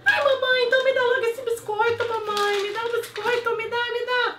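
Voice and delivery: very high, loud squeaky puppy voice; dog's voice. Acted. Dog Clarinha answering her owner, squeaky puppy voice. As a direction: squeak like a nervous little dog. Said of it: "o cachorro me responde".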